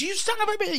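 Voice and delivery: high-pitched imitation of an irate waiter; mock angry yell. Waiter grabbing the thief by the arm, high mock yell. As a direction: curse high, without real volume. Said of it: "yelling at me".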